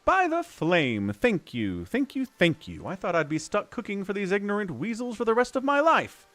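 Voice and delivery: a hint of a relieved dwarf; grateful dwarf cook. Voices rescued dwarf cook dialogue.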